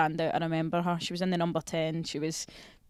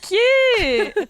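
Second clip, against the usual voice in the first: mock cute squeal; 14 semitones higher, 11 dB louder than usual. Mocking squeal.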